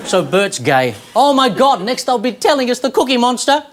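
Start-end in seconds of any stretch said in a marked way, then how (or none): 1.2-3.6 high, exclaiming feigned shock; mock outrage. Comedian reacts to news about Bert, high-pitched mock shock. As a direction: exclaim it higher, as if scandalised.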